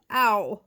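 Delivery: sudden loud ow; yelp of pain. A narrator's reading. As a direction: yelp mid-speech from pain. Slug hurting from the salt.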